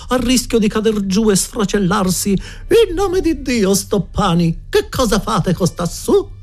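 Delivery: high, drawn-out theatrical lament; declaiming. A narrator's reading. Headmistress finds the boy up at the window, declaiming. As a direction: declaim it slowly in high-pitched horror. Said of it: "la signora Geltrude declamando".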